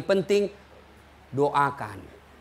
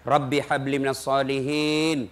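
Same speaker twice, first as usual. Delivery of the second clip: tender prayer; 5 semitones lower, slower than usual. Retold or imitated. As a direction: murmur it lovingly, slowly. Prayer while bathing child.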